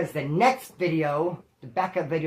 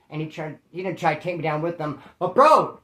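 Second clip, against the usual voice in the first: usual speaking voice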